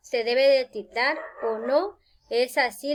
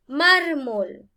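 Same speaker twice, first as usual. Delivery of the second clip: "Mármol" with MAR raised and louder, MOL falling away; stress on first syllable; 2 semitones higher, 4 dB louder than usual. Shown as a demonstration. Teacher showing the greater voice force, stress on first syllable. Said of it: "la mayor fuerza de voz".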